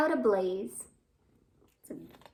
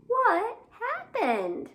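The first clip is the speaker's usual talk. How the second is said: slow, higher, drawn-out question; curious question; 10 semitones higher, 4 dB louder, much slower than usual. Acted. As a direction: ask the worm with wide-eyed curiosity. Asking the worm in the rhyme.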